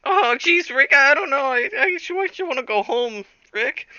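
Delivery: very high, anxious cartoon boy impression; Morty. Morty tells Rick he wants to go home, squeaky Morty impression. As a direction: squeak it out, fretting and stammering. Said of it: "And Morty's like".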